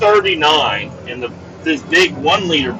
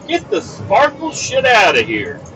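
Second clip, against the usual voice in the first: high-pitched mock indignation; disgusted rejection; 8 semitones higher than usual. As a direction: wave it away in comic disgust. Retold rejection of sparkling water.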